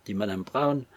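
ordinary delivery

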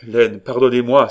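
loud, imploring cry. A narrator's reading. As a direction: burst out begging forgiveness. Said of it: "s'écria Gaston".

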